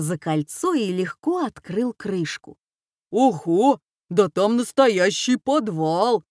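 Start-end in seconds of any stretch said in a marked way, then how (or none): 3.1-6.2 louder put-on oaf voice; astonished oaf. A narrator's reading. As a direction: marvel like a big clumsy lad. Oafish son marvels at cellar. (изумлённый увалень)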